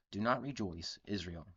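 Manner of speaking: normal talking voice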